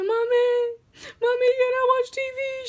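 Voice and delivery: put-on high, loud childish whine; pleading child.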